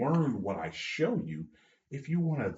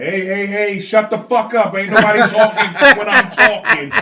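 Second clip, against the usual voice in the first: put-on loud, higher barking yell at unruly kids; mock coach yell; 7 semitones higher, 13 dB louder than usual. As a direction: yell it like a fed-up coach silencing the room. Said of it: "I didn't say it quite like this".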